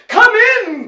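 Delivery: high, loud royal call; king's booming cry. A narrator's reading. King inviting them in, booming cry. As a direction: boom it out like a hearty king. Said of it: "cried the king with a boom".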